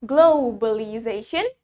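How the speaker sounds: "Globalization" slowly, with a drawn-out "glow" and W glide; slow British-style "Globalization", long rounded first vowel. Shown as a demonstration. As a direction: stretch the O of "Globalization" into "oh-w". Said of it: "In British, we say it".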